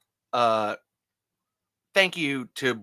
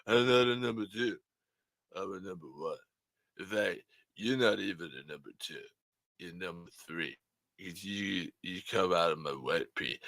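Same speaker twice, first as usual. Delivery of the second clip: Stallone impression; 5 semitones lower, 6 dB quieter than usual. Stallone phoning the podcast hosts, in a lower Stallone voice. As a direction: do Sylvester Stallone confessing to the hosts. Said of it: "I was trying to do Stallone".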